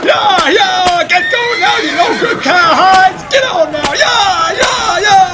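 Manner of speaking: loud, high driving yells; yelling at horses. Acted. Driver yelling at the horses to run, loud driving shouts. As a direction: whip the team on with your voice.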